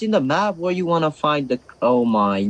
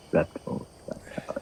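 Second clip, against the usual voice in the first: whispering; 12 dB quieter than usual. Real, not acted. Repeating name to himself.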